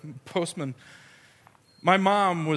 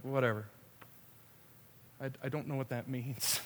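low, soft, offhand brush-off; dismissive mother; 4 semitones lower, 12 dB quieter than usual. Retold or imitated. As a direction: shrug it off under your voice. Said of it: "just shaking her head like".

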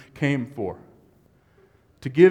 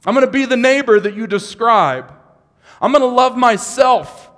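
much higher and louder, preaching at full force; impassioned vow to God; 6 semitones higher, 9 dB louder than usual. Acted. Preacher voicing a believer's vow to God, at full force. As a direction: pour out a believer's pledge at full volume.

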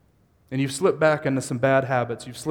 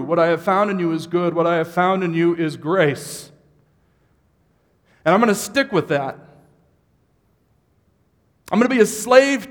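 much higher and louder, preaching at full force; impassioned vow to God; 6 semitones higher, 9 dB louder than usual. Acted. Preacher voicing a believer's vow to God, at full force. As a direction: pour out a believer's pledge at full volume.

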